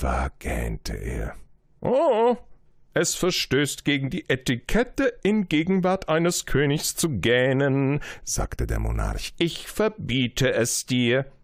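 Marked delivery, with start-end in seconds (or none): 1.8-11.3 a proud king beckoning his subject. Acted. King forbids yawning.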